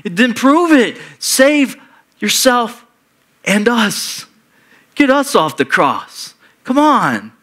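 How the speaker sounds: high, urgent, goading taunt; taunting criminal. Retold or imitated. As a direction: dare him to prove it. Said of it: "this criminal who's guilty says to him".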